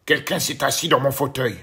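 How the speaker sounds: loud booming papa bear; big gruff Papa Bear voice. A narrator's reading. Papa Bear growls at the empty chair, loud and big. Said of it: "d'une très grosse voix".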